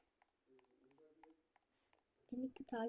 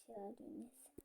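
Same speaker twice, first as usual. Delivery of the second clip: whisper; 5 semitones lower, 26 dB quieter than usual. Real, not acted. Aside about her mother.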